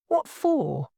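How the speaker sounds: taken aback, asking the reason; startled. A narrator's reading. Lawyer questioning the need for a cab, startled. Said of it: "in a startled tone".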